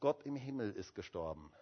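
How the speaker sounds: low, hushed, mournful announcement; very sad voice, imitated. Wife telling Luther who has died, very sadly. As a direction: break grave news softly, as a grieving wife. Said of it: "mit ganz trauriger Stimme".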